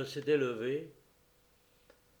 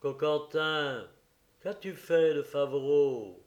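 hammering; 6 dB louder, slower than usual. Acted. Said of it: "Diana martelait".

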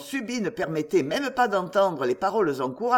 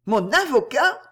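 a desperate cry, pitched higher and louder; despairing interruption; 5 semitones higher, 5 dB louder than usual. A narrator's reading. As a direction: cut in with despair. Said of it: "interrompit Gertrude avec désespoir".

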